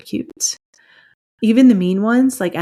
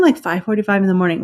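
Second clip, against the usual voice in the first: normal talking voice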